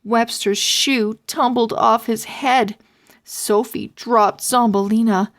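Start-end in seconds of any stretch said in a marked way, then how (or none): none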